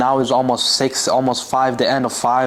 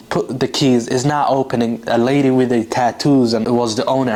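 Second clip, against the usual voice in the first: ordinary delivery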